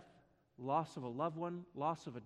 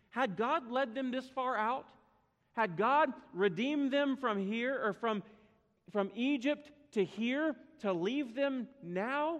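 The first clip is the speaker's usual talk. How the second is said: higher and louder, pressing each question harder; rising preacher questions; 6 semitones higher, 5 dB louder than usual. Real, not acted. Preacher pressing the church with rhetorical questions, rising. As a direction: build the questions like a preacher in full flow.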